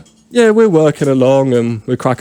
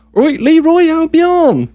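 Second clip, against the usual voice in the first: slow, much higher and louder, hollering from a van; calling out a name; 4 dB louder, much slower than usual. Retold or imitated. Van driver hanging out the window calls a name, slow loud holler.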